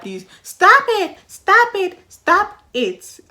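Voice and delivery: high, loud, slow stop it; pleading. Real, not acted. Begging guys who shout on calls, loud plea. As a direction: beg them to stop, loudly. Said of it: "pleading with guys".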